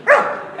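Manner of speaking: loud imitated dog barks; two sharp, high mock woofs. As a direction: bark like an eager dog. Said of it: "he ended up doing a dog".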